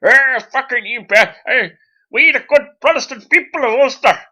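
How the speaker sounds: high, loud, badly faked Ulster accent; Ian Paisley. Preacher addressing Protestant people of Ulster, mock Ulster accent bellow. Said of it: "I can't do an Ulster accent".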